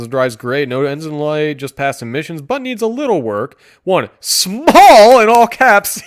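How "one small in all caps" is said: loud, slow, high mock yell; yelling in caps. Reviewer reading an all-caps ad aloud, loud mock yell. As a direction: bellow the words like an all-caps ad. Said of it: "you yell it in all capitals".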